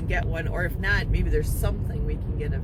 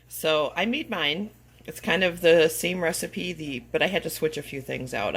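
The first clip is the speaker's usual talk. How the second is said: normal talking voice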